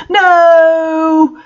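high loud cry of no; hammed-up anguished no; group yell. A narrator's reading. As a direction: bellow it out in despair. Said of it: "yielded everyone".

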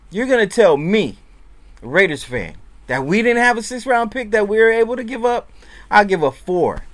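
high, loud rhetorical challenge; indignant rant. Real, not acted. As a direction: demand an answer from the fans, fired up. Ranting at fellow Raiders fans.

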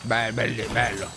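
deep drawling bello; low mock voice. Low mock-pleased voice on loot. (voce bassa compiaciuta)

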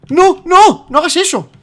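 high panicked shriek. Real, not acted. Gamer shrieks at his friend in the lava. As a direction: shriek at him to stop. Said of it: "deja de chillar".

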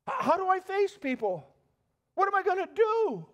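high, loud, panicking; panicked cry. Pretend. Naaman panics in front of his men, high and loud. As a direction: blurt it out in rising panic. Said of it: "Is he thinking".

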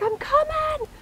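high-pitched retold yell; frantic calling back. Wife answering her screaming husband from the cabin, frantic high yell.

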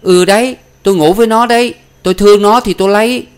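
very high, loud, sour-tongued retort; sharp-tongued daughter shouting back; tart defiance. Acted. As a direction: throw it back spitefully. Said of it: "vừa chanh chua".

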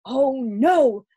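high, loud cry of dismay; a burst of worried dismay; dismayed exclamation. A narrator's reading. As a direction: exclaim it in sudden alarm. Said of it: "thought Kirsty as she ran".

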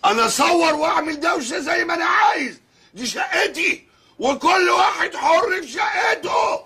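indignant high-pitched shouting; angry shout. Acted. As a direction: shout him down, it's your flat. Defiant quarrel with flatmate.

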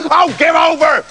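high, fed-up shout; exasperated farmer. A narrator's reading. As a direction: cut him off with an exasperated yell. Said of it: "said the farmer".

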